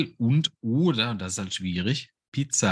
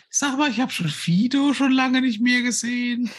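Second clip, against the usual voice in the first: wife voice; 14 semitones higher, 4 dB louder than usual. Acted. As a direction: chirp it like a doting wife. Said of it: "du bist meine Frau".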